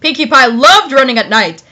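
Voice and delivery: ordinary delivery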